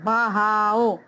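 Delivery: बहाओ said as ba-ha-o, each sound apart; split syllables. Shown as a demonstration. Teacher splitting बहाओ for students. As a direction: separate बा and हे in बहाओ. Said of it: "बा अलग जा रहा है".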